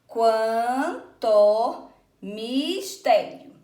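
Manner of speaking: "Quan, to, mis, tério" very slow, each piece held and set apart; syllable by syllable. Shown as a demonstration. Teacher counting poetic syllables with pupils, slowly syllable by syllable. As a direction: chop "quanto mistério" into long, separate syllables. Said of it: "Então, vamos contar aqui".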